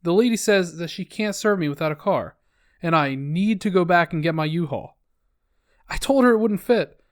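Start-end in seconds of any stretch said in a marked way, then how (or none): none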